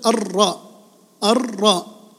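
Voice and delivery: الراء as ar-ra with a held trill; doubled ra. Shown as a demonstration. Teacher isolating the letter with shadda, trilled R in الراء. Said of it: "الراء مشددة".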